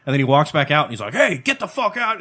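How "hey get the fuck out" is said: a high mock yell at the deer; retold yell. Dad yells at the deer in his garage, mock yell. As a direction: imitate dad yelling from the garage. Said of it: "and starts yelling".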